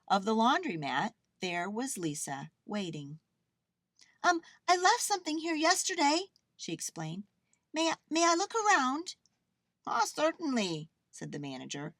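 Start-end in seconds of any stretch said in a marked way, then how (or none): 4.2-6.3 put-on high, quick, louder girl; hurried high-pitched little girl; Lisa. A narrator's reading. Girl explains to the manager, hurried. 7.7-9.1 put-on high, quick, eager girl; Lisa. A narrator's reading. Girl asks the manager to search, eager. 9.9-10.9 lightly voiced obliging manager; higher, agreeable reply. A narrator's reading. Manager lets the girl look, obliging.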